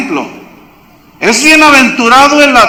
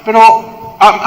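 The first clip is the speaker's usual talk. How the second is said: usual speaking voice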